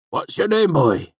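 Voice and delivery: high, loud rustic question; curious old villager. A narrator's reading. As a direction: squint and ask, curious and loud. Cobb questions newcomer.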